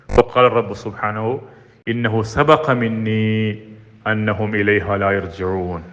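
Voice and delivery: slow measured Arabic recitation; Quran recitation. Acted. Preacher intoning the prayer to the congregation, slow recitation. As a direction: intone the verse slowly.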